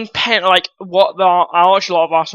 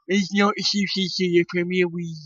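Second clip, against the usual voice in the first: lightly put-on Portuguese accent, much softer; a quiet, mocking hint of a Portuguese accent; Jose Mourinho's Portuguese accent; 2 semitones lower, 9 dB quieter than usual. Mocking the rival manager's claim, in a soft, lightly Portuguese accent. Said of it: "Portuguese accent".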